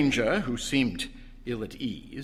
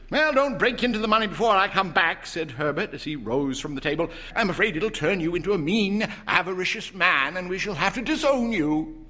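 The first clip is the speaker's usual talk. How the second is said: put-on higher, playful young man; mock warning; teasing son; 7 semitones higher, 3 dB louder than usual. A narrator's reading. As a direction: son teasing parents leaving for work. Herbert teasing.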